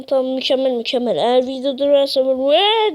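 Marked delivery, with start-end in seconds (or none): 2.4-2.9 high, loud "vaa" cry; demonstrated yell. Shown as a demonstration. Gamer showing viewers how he would shout if video stops, mock yell. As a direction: yell it out as an example. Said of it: "diye bağırırsam böyle".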